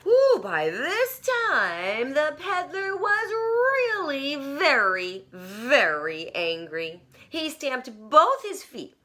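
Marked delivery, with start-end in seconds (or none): 0.0-7.0 dramatic emphasis. A narrator's reading. Building anger narrated.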